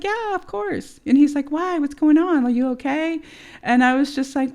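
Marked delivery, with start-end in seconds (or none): 0.0-1.0 high-pitched mock reassurance; imitated breezy reply. Imitating boyfriend saying he is alone, mock breezy. 1.5-3.2 high, louder mock-concerned questions; imitated concern. Imitating boyfriend on the phone, mock concern.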